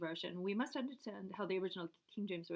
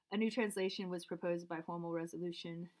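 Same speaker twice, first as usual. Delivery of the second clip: ordinary talk, nothing marked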